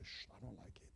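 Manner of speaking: whispered shh and refusal; whispered shush. Acted. Preacher acting a believer hushing the Spirit. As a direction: hush it dismissively in a whisper. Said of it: "you say".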